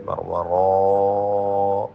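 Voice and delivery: ورا with the aa held very long; long madd. Shown as a demonstration. Teacher showing the long madd in ورا. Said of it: "مد واجب ہے".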